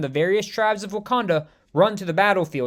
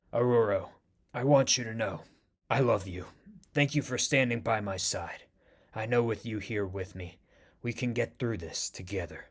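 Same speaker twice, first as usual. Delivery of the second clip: much softer, lower, gentle; tender confession; 8 semitones lower, 9 dB quieter than usual. Acted. Wounded T'Challa softly telling Storm he loves her. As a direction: speak your love quietly.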